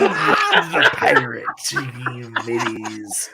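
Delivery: slow drawn-out pirate growl. Pretend. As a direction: stretch it out like a pirate. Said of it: "that's how you do a pirate voice".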